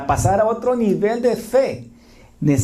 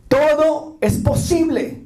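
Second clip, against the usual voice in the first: exultant proclamation; 10 semitones higher, slower than usual. Acted. Preacher proclaims Jesus's promise.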